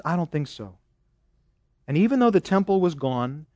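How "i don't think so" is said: much softer, a hushed dry put-down; quiet dismissal. Acted. Preacher voicing God's answer, hushed and dry. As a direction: let it drop to a soft, knowing no.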